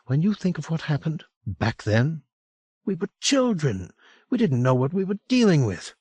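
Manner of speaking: put-on remembering something frightening from childhood; Franny, troubled memory. A narrator's reading.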